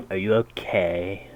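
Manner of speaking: put-on slurred drunk call; slow slurring drunk asking; drunken voice. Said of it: "a drunken voice calls out".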